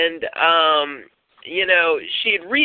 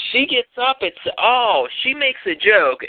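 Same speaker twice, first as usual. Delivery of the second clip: ordinary delivery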